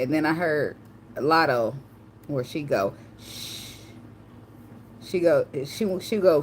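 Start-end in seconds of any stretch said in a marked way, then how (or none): 3.2-3.6 soft drawn-out shh; shushing. Retold or imitated. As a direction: hush someone with a long shh. Retelling a shushing.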